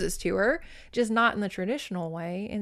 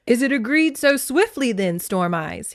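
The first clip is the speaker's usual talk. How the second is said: louder, higher, playful opening; pleased banter; 4 semitones higher, 6 dB louder than usual. A narrator's reading. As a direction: banter with a touch of eager pleasure. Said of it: "he bantered, grinning broadly with pleasure".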